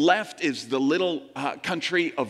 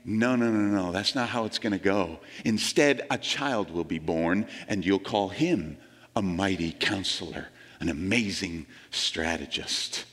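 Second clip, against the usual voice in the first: a hint of a lower, softer prophet voice; prophet's answer; 7 semitones lower, 4 dB quieter than usual. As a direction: calmly overrule them as Isaiah, a bit lower. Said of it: "Isaiah is prophesying ... and saying".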